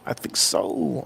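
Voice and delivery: slow unvoiced whisper. Real, not acted. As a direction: murmur it to yourself in a whisper. Aside muttered.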